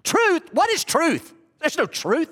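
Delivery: scoffing, sarcastic Pilate; sarcastic mockery. Shown as a demonstration. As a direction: ridicule the very idea of truth. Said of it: "Is it this?".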